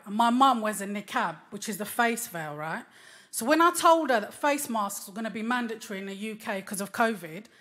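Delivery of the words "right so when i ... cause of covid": ordinary talk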